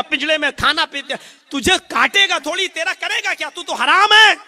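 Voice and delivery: high, loud mocking pigeon taunt; scolding pigeon. Pigeon taunting the crow in the trap, high and loud. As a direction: jeer at the crow that it's haram.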